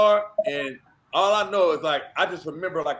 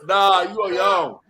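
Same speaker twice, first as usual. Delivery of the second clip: loud refusal; 7 dB louder, much slower than usual. Retold or imitated. Refusing, loud then slow.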